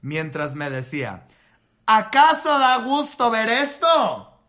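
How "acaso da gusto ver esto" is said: high, loud, slow scolding mother; an angry mother scolding her son, high, loud and slow. Retold or imitated. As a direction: scold the boy hard, dragging each word out. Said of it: "me empezó a gritar".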